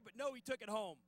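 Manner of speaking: usual speaking voice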